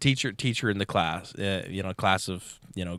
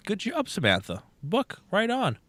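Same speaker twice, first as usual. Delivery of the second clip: high, sing-song teacher voice; teacher calling on Samantha, high and sugary; sweet teacher praise; 11 semitones higher than usual. Retold or imitated. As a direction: chirp the praise like a grade school teacher. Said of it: "so she goes".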